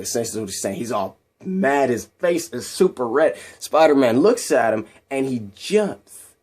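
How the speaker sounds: ordinary delivery, nothing marked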